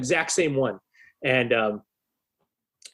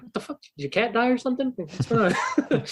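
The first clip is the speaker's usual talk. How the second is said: higher, half-laughing disbelief; incredulous outburst; 8 semitones higher than usual. Retold or imitated. Reacting to his bandmate's gloomy face, incredulous laugh. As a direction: blurt it out, baffled and amused. Shocked at friend's somber mood.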